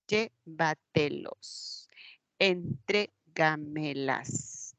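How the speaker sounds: Llévatelos and Entrégamelas slowly split into syllables. Shown as a demonstration. Teacher showing sobresdrújulas, Llévatelos and Entrégamelas slowly syllable by syllable. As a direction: softly count out each syllable of Llévatelos, Entrégamelas. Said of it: "Y es anterior a la antepenúltima sílaba".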